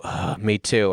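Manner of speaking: muttered under the breath; feed the line quietly; quiet, low prompted line. Shown as a demonstration. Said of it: "then you're gonna go".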